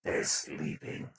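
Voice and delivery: hushed whisper through the filter. Acted. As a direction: whisper so nobody wakes up. Hushing, someone is sleeping.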